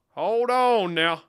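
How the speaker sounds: slow, high, loud call; drawn-out holler. Pretend. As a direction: stretch the words out and holler them.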